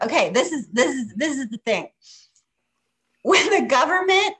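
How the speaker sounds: ordinary talk, nothing marked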